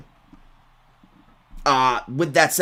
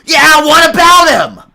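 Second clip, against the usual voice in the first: furious high-pitched yell, far louder than talk; venom-spewing scream; 17 dB louder, slower than usual. Retold or imitated. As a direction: scream it like a total crash-out. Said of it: "And I just scream, venom spewing".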